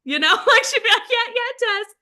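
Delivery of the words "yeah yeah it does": high, giggly mock-timid reply; imitating a bashful seatmate; shy stranger. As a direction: agree shyly in a high voice. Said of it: "Like, they're shy".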